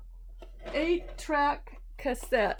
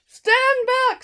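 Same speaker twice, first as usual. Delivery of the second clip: loud, high warning elephant; 14 semitones higher, 11 dB louder than usual. Acted. Elephant warning his friends he must sneeze, high and loud. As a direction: belt it out as the big elephant.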